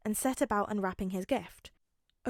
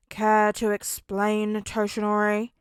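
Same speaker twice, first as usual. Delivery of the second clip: pointed question; 6 dB louder, slower than usual. A narrator's reading. Aizawa presses Toshinori.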